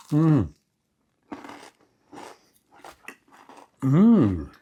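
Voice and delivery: rising pleasured hum at the first bite; savoring moan, high and drawn out; delighted mm. Real, not acted.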